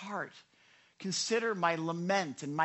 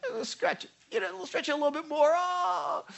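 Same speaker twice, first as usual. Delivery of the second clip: high, squeezed voice, quick, ending in a moan; strained mock stretching; 11 semitones higher, 3 dB louder than usual. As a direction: squeal it as if being stretched. Acts out being stretched by God.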